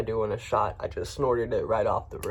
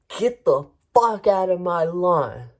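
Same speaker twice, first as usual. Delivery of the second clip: slow, louder, higher furious shout at a trespasser; retold yell of a homeowner chasing him off; imitating angry yell; 6 semitones higher, 6 dB louder than usual. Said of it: "he starts yelling at me".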